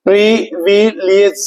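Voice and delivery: Privilege in three slow, separated syllables. Shown as a demonstration. Teacher showing students the spelling by sound, syllable by syllable. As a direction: break privilege slowly into its sounds, pausing between each. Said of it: "syllable के base पे याद करें".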